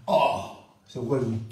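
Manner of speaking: normal talking voice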